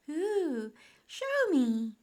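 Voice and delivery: put-on high, loud, excited little boy; eager William. A narrator's reading. Boy begging to see Grandpa's photo album, eager. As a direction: pipe up with childlike delight. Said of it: "said William, snuggling up".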